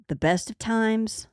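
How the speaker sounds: ordinary delivery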